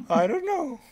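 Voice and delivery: high, unsettling giggle while talking; creepy laugh. Acted. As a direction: laugh it off eerily. GM voices the farmer creepily.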